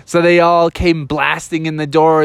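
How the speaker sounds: usual speaking voice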